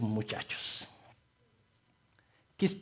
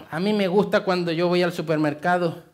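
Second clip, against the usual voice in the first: normal talking voice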